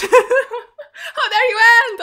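loud and high, laughing through it, slow; mock-outraged cry through laughter; laughing outrage. Real, not acted. Viewer reacting to the episode ending, laughing and loud.

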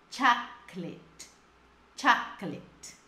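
CHOCOLATE slowly split as "CHAK ... klet"; CHOCOLATE slow, with a gap after the stressed "CHAK"; careful pronunciation. Shown as a demonstration. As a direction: model CHOCOLATE in separated syllables. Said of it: "Essa palavra é muito pronunciada errada".

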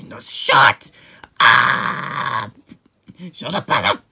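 loud, drawn-out shut up; angry shout. Acted. Telling off a brother for ogling Peach, angry shout. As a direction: yell to silence your brother. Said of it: "calm down".

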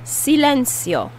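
"Silencio" with the C as an S. Shown as a demonstration. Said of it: "pronounced like an S".

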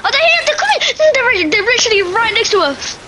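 frantic panic as they close in; being chased. Real, not acted. Enemies closing in, fleeing.

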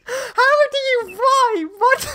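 very high squeal of disbelief; incredulous. Retold or imitated. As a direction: splutter in mock bewilderment, about to crack up. Retelling bafflement at dad's keyboard fakery.

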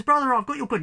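high, loud excited call. Acted. Older brother calling to his brother, loud high shout. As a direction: shout the news to your brother. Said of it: "called out, says".

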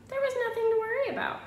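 a father bursting with cheer; excited father. Acted. Father tells Wimberly all went well, high excited voice.

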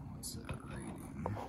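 under the breath while checking the gauge; whisper. Real, not acted. Reading gauge, muttering to himself.

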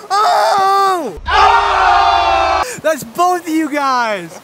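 loud high cheering shouts; excited shouting. Real, not acted. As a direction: whoop and holler in triumph. Both friends lost the game.

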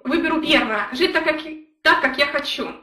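ordinary talk, nothing marked

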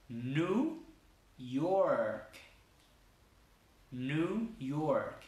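York with a long r. Shown as a demonstration. Teacher modelling New York for learners. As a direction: stretch the R in York. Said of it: "la R en inglés también es bien larga".

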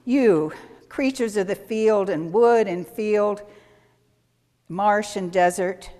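solemn blessing for the animals; formal reading. Acted. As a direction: read it like a liturgy. Reads a blessing to congregation.

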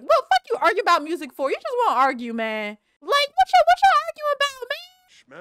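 high, loud, fast exasperated shout; mock yelling family. Vlogger voicing herself yelling at arguing Sims, high and loud. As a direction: yell at the squabbling family.

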